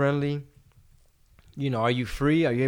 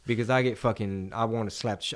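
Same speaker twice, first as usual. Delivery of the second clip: usual speaking voice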